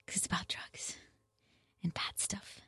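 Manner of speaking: hushed aside; whisper. Acted. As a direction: drop to a conspiratorial whisper. Confiding aside about drugs.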